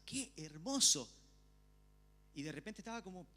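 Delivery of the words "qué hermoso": delighted exclamation. Retold or imitated. Retelling delight reading the Bible.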